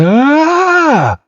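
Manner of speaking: long, very high scream; acted cry of terror; mock scream. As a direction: shriek at a sudden monster. Red sees huge Kangaskhan.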